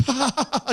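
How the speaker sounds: high, spoken-out fake laugh; imitated mocking laugh. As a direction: lean back into a mock ha-ha. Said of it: "He just leans back and laughs".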